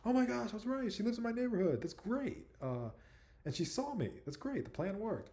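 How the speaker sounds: high-pitched mock-giddy celebration; retold elation. Retelling his thrill that his crush lives nearby, giddy and high. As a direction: squeal it out like an imitated inner cheer.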